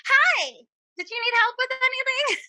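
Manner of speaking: high, sing-song clerk voice; overly sweet store-clerk greeting; fake casual shop clerk. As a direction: play it cool as a helpful clerk. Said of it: "I'm like".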